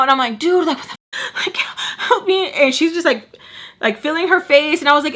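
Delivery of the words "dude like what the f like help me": mock freaking out. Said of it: "like, freaking out".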